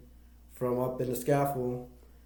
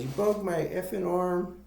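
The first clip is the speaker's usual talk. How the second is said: higher-pitched, mildly angry retelling; mock angry accusation; 6 semitones higher than usual. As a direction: act out an accusation with mild outrage. Bud confronting Wes, retold.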